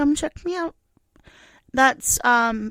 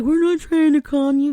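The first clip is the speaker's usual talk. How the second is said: mock denial; 5 semitones higher, 9 dB louder than usual. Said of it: "And they're like".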